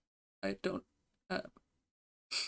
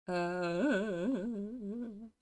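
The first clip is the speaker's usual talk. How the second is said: normal talking voice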